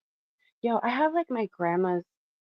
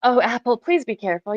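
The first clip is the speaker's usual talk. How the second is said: louder worried call to the dog; 4 semitones higher, 6 dB louder than usual. Real, not acted. Dog almost falling.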